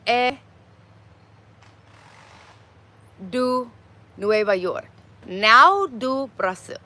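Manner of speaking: very slow, word-by-word Portuguese with long pauses; halting learner Portuguese. Real, not acted. Learner practising Portuguese for the chat, halting and careful.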